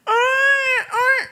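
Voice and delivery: high-pitched mock baby wail; imitated child's crying. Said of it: "Anak nangis".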